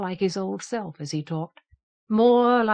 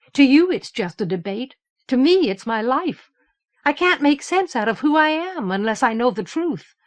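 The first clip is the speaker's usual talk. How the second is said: raised, urgent, pleading voice; impassioned plea; 5 semitones higher, 6 dB louder than usual. Acted. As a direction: push it out, she's fighting for herself. Teresa pleads her case passionately.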